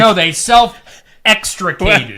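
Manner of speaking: slow, stressed self-extricated; mock official stress. Mocking the fire report's jargon, slow and stressed. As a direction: lean hard on self-extricated.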